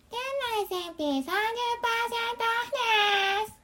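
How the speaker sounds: high singsong shop announcement; store sale announcement. Retold or imitated. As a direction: chirp the sale like store staff. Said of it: "店内全品三十パーセントオフですのお店だ".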